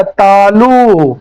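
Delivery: तालु stretched very slowly; drawn-out key term. Shown as a demonstration. Teacher giving students the articulation place, drawn out slowly. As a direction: draw out both syllables of तालु.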